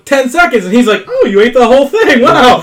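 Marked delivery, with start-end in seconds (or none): 1.1-2.6 exaggerated fake amazement, laughing through it; mock surprise. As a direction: ham up the husband's phony shock, higher and louder. Acting out husband's fake surprise.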